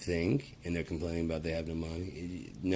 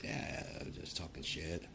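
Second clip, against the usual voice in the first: whispered, much softer excuse; mock sheepish whisper; 8 dB quieter than usual. Imitating a man brushing off his threat, sheepish. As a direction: mumble an embarrassed excuse. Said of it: "and you're like".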